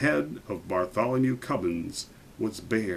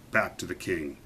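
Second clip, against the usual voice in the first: ordinary delivery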